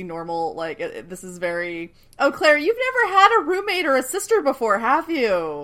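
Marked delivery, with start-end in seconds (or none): 2.2-5.7 high, loud teasing; mock knowing. Talking to Claire about never having a sister, mock knowing. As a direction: tease her in a raised, put-on voice. Mock address to the naive character.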